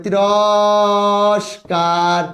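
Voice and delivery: তিরস্কার stretched very slowly; drawn-out demo. Shown as a demonstration. Teacher contrasting spellings, very slow. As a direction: lay out তিরস্কার syllable by syllable. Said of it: "দেখো".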